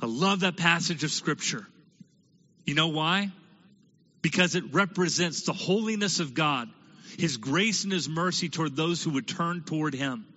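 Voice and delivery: ordinary talk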